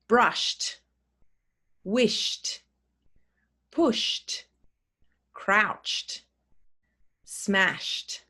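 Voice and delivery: each word ends in a crisp t: Brushed, wished, pushed; slow word list for a class; t ending. Shown as a demonstration. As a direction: model the final t on every word. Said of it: "Listen carefully when I say them".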